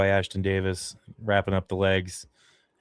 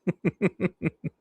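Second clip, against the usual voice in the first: sheepish laugh; 9 semitones higher than usual. Real, not acted. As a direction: giggle at your own outburst. Laughs at own outburst.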